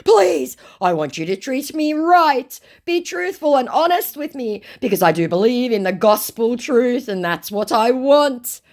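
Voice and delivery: very high, hammed-up pleading; nonsensical scammer. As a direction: plead loudly as a ridiculous scammer. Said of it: "said my nonsensical idiot".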